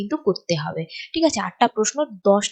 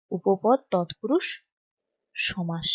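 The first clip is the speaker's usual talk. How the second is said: drawn-out term, pause before the last word; spaced-out writing-along delivery; slow dictation; 3 semitones lower, 3 dB quieter, much slower than usual. Shown as a demonstration. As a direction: dictate slowly while writing, dropping softer at the end.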